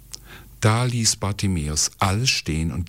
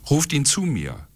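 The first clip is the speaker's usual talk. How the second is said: higher, calling command; 8 semitones higher than usual. A narrator's reading. Jesus orders the crowd, commanding call. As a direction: give the order in a raised pitch. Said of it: "befahl er".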